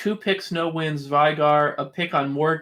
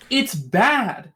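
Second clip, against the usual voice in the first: "It's bad" cried out high and louder; exasperated; 7 semitones higher, 3 dB louder than usual. Real, not acted. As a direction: throw up your hands: "It's bad!". Exasperated repeat of verdict.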